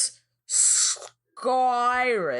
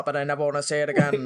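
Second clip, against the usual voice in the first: fast, lower deadpan line; 6 semitones lower, much faster than usual. Retold or imitated. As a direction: rattle it off with no emotion. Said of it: "no emotion to it".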